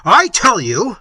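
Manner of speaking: loud, commanding declaration; Manfred imperiously overruling Isabella. Acted. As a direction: lay down the law like a tyrant. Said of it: "said Manfred imperiously".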